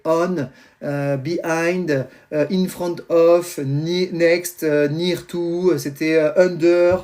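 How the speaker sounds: English prepositions in a thick French accent; slow, halting English with a French accent. Real, not acted. Dad lists his daughter's English vocabulary, French accent. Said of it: "mon accent anglais est extraordinaire".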